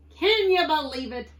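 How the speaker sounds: high, loud pirate lament; exasperated moan. A narrator's reading. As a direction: moan it to your parrot. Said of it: "Pete moaned to the parrot".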